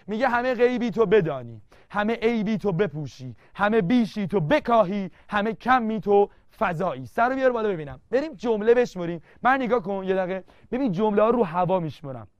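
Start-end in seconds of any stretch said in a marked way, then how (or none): 0.3-7.1 reciting the verse to the class, a bit louder; poem recitation. Acted. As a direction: declaim each line of the poem. (خواندن شعر با لحن شعرخوانی)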